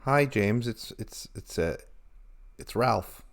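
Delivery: low, halting cool-guy voice; put-on cool voice. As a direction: drop your voice to sound cool. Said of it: "this is just a voice you're doing".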